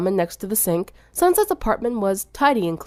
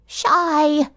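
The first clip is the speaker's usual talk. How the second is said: strained; 8 semitones higher than usual. A narrator's reading. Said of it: "she said in a strained voice".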